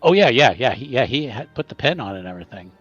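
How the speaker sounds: ordinary delivery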